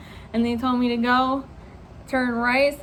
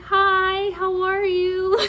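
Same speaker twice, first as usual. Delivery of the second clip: high-pitched nervous hello, slowing at the end; scared greeting; 6 semitones higher, much slower than usual. Retold or imitated. Nervous student greeting the burly examiner, scared. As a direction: imitate a terrified, over-polite greeting. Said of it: "Scared out of my fucking mind".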